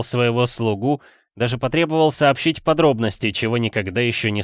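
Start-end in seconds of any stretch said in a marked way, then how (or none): none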